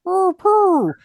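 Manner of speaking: failed Pooh voice. Retold or imitated. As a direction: reach up for the Pooh voice and miss it. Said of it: "that doesn't sound like Pooh".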